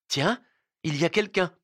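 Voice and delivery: high, lightly surprised remark; curious. A narrator's reading. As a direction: raise the pitch with sudden curiosity. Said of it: "dit Ninotchka avec curiosité".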